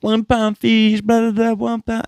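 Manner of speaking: mock street-vendor sales chant; imitating the one pound fish seller. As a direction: hawk fish to passing ladies like the one pound fish guy. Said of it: "he's like".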